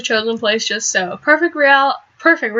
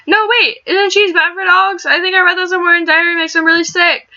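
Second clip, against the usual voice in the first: Mari's worried voice; 6 semitones higher than usual. Acted. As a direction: voice the anxious friend warning about cheese. Voicing Mari's worried warning.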